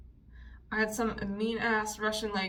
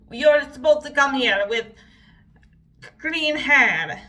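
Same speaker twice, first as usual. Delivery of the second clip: loud, drawn-out mock Russian accent; 3 semitones higher, 8 dB louder, slower than usual. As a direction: scold like a mean Russian hairdresser with the accent. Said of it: "doing that accent throughout this little".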